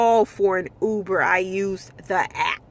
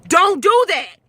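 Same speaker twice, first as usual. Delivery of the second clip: higher and louder, a firm order; retold yell; 7 semitones higher, 5 dB louder than usual. Driver yelling at a passenger tapping her, sharp order. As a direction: snap it out to stop him. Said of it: "And I'm like".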